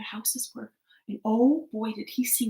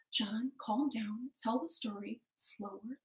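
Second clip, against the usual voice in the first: calming wife; 9 dB quieter than usual. Acted.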